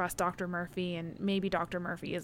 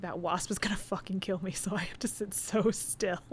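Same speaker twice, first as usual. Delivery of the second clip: whispering. Retold or imitated. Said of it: "she's like".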